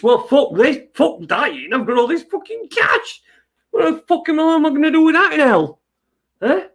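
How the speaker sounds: high, mocking voice that climbs and speeds up at the end; mocking a chancer. Mocking the man who got the GoFundMe cash, high and gleeful. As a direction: sneer at him by playing him as a gleeful idiot.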